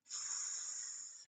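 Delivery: unvoiced long hiss "S". Shown as a demonstration. Teacher hissing S for the snake word. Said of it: "Did you hear it?".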